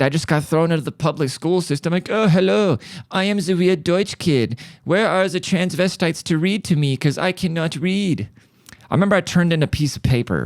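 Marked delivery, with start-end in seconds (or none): 2.1-8.3 a lightly put-on German accent, pitched up. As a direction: ham up a clueless German kid, lightly. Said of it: "I can't even do a German accent".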